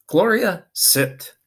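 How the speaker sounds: loud, high call of the dog's name, then a firm sit; command. A narrator's reading. Officer Buckle commands Gloria in front of the children, loud. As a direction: order the dog to sit.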